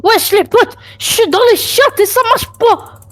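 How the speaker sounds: high frustrated call; exasperated plea to the team; raging gamer. Real, not acted. Stuck, calling his teammates.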